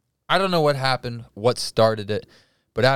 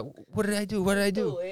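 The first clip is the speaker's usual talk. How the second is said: higher-pitched imitated Italian protest; mild Italian accent; 8 semitones higher, 4 dB quieter than usual. Storyteller redoing the Italian phone guy's question, light accent. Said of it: "He was Italian".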